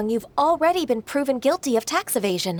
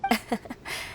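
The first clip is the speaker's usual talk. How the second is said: quiet modest chuckle; 8 dB quieter than usual. Acted. Modest reply to praise.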